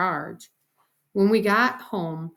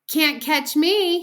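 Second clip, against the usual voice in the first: put-on high, loud, drawn-out little-kid taunt; taunting toddler yell; hollered taunt; 9 semitones higher, 7 dB louder, slower than usual. A narrator's reading. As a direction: holler it in a slow singsong tease. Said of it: "he hollered".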